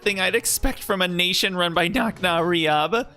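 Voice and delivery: a fighter rallying allies to storm the castle; battle-ready leader. Acted. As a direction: call the team to arms. Character rallies group to fight.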